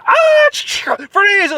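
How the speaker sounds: high loud police yell; mock police bark, very high and loud; cop shout. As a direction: storm in yelling like a cop. Police jump the fence.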